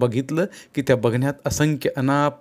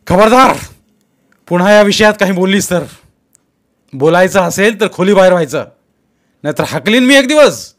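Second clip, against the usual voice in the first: loud, higher furious warning; commanding threat; 7 semitones higher, 11 dB louder than usual. Acted. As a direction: bark the order at her. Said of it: "बायकोला फरमावलं".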